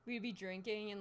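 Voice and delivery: usual speaking voice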